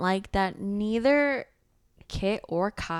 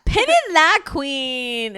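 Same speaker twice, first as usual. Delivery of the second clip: high, loud playful echo; sassy echo; 5 semitones higher, 8 dB louder, much slower than usual. Real, not acted. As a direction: toss it back with sass. Echoing friend playfully.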